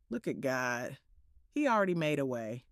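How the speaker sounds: put-on hushed, trusting old woman; spry old voice. Grandma's faith quote, voiced.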